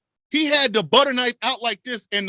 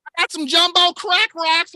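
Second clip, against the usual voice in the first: higher, louder eager sales pitch; hyped dealer; 8 semitones higher, 5 dB louder than usual. Pretend. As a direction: sell it like a corny street dealer. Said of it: "whitest drug dealer".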